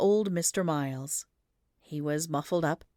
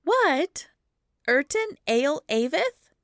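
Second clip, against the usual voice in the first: puzzled repeat; 10 semitones higher, 5 dB louder, slower than usual. A narrator's reading.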